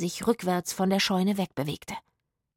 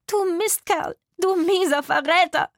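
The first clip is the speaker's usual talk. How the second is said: much higher, louder shriek of insults; furious screaming; 10 semitones higher, 5 dB louder than usual. A narrator's reading. Martha attacking Hugo with her fists, furious screaming. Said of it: "schrie sie".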